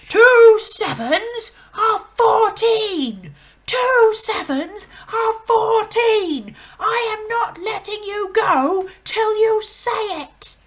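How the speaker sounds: very loud, high, slow roar; bellowed. Acted. Headmistress roars the answer at Rupert, very loud and slow. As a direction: roar it at the dangling boy. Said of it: "Miss Trunchbull bellowed".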